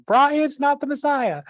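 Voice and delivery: screechy-high, drawn-out comic woman impression; Terry Jones. Preacher quoting a film as the wise woman, mock shrieking. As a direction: imitate a comic old mother, very high and loud. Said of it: "it's Terry Jones instead, uh, saying".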